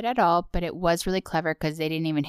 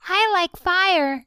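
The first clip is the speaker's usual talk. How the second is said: Ralph Wiggum voice; 11 semitones higher, 3 dB louder, slower than usual. Retold or imitated. Ralph impressions trade.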